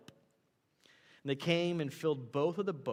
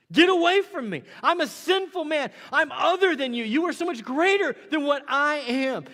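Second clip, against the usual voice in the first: much higher and louder, crying out; Peter's desperate cry; 13 semitones higher, 15 dB louder than usual. Acted. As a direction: cry out on your knees in awe. Peter's cry voiced at peak.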